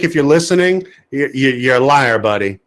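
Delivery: ordinary talk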